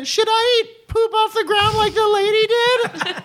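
very high little cartoon boy; Rod Flanders. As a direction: ask in a piping put-on child's voice. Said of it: "Rod's like".

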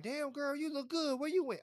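high, loud flirty come-on; a playful pickup line; flirty catcall.

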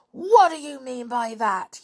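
high, loud angry yell; furious yell. A narrator's reading. George yelling at Lou in fury, loud and high. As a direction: hurl the question at him. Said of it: "yelled George, still in a furious temper".

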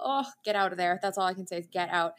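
ordinary talk, nothing marked

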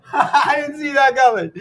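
laughing in disbelief. Real, not acted. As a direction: laugh through it. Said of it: "I did not see that one coming".

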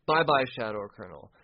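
ordinary delivery

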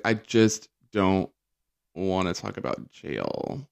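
slow, dragged-out reluctance; mock-pained, halting delivery; reluctant retelling. Voices Teresa reluctant about jail.

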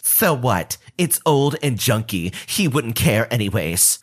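put-on voice of a pushy entitled mom; entitled Karen. Narrator voicing the Karen haggling for the Game Boy, put-on pushy voice. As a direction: play the pushy mother lowballing a kid. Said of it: "She says".

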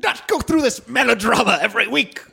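high, exasperated melodramatic rant; fussy son protesting in high falling phrases; Frasier. Acted. Son complaining to dad about radio duty, high exasperation. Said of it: "I'm Frasier".

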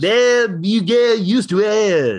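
high, slow put-on singer voice with a laugh; mock singer voice. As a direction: keep the silly singer bit going. Mock gibberish singer continues.

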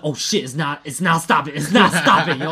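retelling the panic as the blood won't stop; panicked. Retold or imitated. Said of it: "I was like".

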